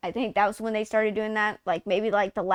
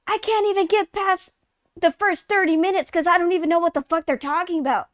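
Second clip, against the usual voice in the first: much higher, whiny complaint; exasperated reply; 5 semitones higher than usual. Retold or imitated. Answering fans about the game, high exasperated whine. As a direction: protest that it makes no sense. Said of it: "And I'm like".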